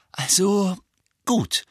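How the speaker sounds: high, halting, brittle delivery. A narrator's reading. Mother reluctantly giving in at the table, brittle voice. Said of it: "mit brüchiger Stimme".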